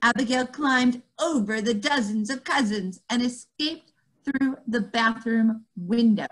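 ordinary delivery

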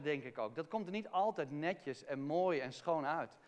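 ordinary talk

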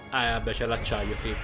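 usual speaking voice

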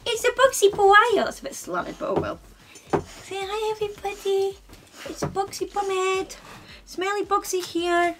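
high, loud doting pet voice; high coo introducing Bugsy to viewers; talking to the dog. Real, not acted. Baby talk introducing the dog.

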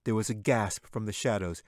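usual speaking voice

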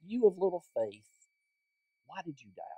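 soft, hushed reproof, almost to himself; private rebuke. Acted. Jesus quietly rebuking Peter as he sinks, hushed. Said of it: "He did that in private".

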